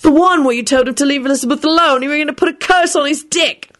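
high-pitched accusation; indignant accuser. A narrator's reading. Angry accusation in argument.

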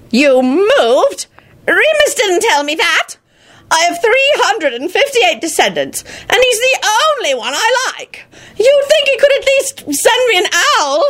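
put-on very high, aggrieved old man; crotchety, high-pitched old wizard grumbling; indignant old wizard. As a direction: complain like an offended grandfather. Old wizard complains about his relative.

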